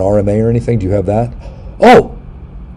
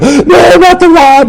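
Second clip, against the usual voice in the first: high shrieking no, not the rod; mock terrified cry; 7 dB louder, much slower than usual. Man dreading his colonoscopy, mock panicked shriek. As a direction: panic squeal at the sight of the rod. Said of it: "I'm like".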